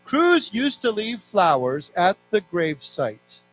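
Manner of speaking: slow, evenly spaced phrases with long pauses; read-along. Shown as a demonstration. Teacher leading students through a text, slow read-along. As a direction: read slowly, leaving room for repeats. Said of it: "So let's read this together".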